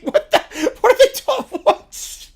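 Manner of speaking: high laughing what; cracking up in shock; laughing at the chaos; laughing disbelief. Real, not acted.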